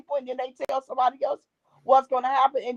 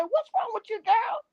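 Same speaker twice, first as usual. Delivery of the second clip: concerned mother; 11 semitones higher than usual. Retold or imitated. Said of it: "She said".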